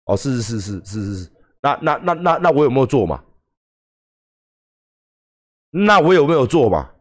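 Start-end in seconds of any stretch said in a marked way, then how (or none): 0.1-3.2 meek yes. Retold or imitated. Playing meek under critics' attack.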